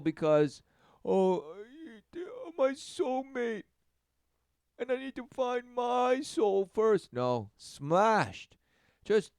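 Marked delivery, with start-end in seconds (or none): 1.0-7.1 much higher, slow swooning mock voice; high breathy mock longing; mock dreamy romantic. Mocking romantic seeker.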